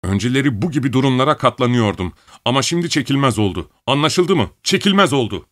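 snapping back at father; angry. Acted. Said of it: "çok sinirlendi".

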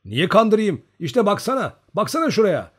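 high, loud indignant protest; shouting. A narrator's reading. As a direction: yell at them to look. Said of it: "diye bağırdı".

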